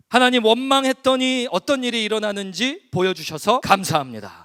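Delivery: high, loud, driving public prayer; fervent prayer. Real, not acted. Preacher leading the congregation's closing prayer to God, fervent loud praying. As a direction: pray aloud with force, pressing through each phrase.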